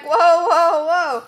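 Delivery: loud, high startled protest; a kid's outraged "whoa", laughing at the end. Retold or imitated. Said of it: "she's like".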